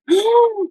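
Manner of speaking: a sharp, high gasp of alarm; shocked gasp. Retold or imitated. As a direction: gasp as if catching someone mid-mistake. Midwife finds her on toilet.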